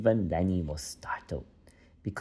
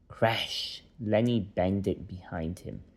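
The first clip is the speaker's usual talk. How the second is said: ordinary delivery, nothing marked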